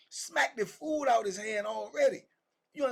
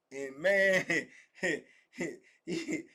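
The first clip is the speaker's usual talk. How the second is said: normal talking voice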